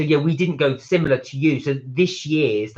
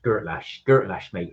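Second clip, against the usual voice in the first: put-on West Country accent; 7 semitones lower than usual. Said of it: "you've gotta say it in a West Country accent".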